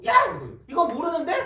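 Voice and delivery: loud, high mock-angry outburst; sudden anger. Student suddenly mad at an unknown value in the problem, mock-angry yell. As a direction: burst out in acted indignation. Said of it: "갑자기 또 막 화나가지고".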